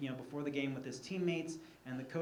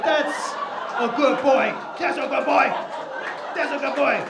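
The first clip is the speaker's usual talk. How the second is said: high, loud praising coo to a dog; dog praise voice; 8 semitones higher, 13 dB louder than usual. Acted. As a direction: praise the dog like it's your baby.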